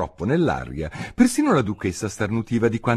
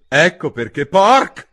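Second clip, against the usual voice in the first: last word blasted, louder and higher; violent outburst; 9 semitones higher, 9 dB louder, slower than usual. Acted. Duchess hurls the word at the baby, violent outburst. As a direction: spit the cut-off word out violently, slowly. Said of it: "pronunciò l'ultima parola con una tale violenza".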